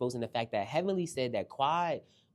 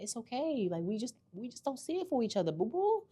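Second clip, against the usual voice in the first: high, mock-sweet brush-off; mock sweet dismissal; 9 semitones higher than usual. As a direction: pat her off like a pet. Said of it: "packed her up and said".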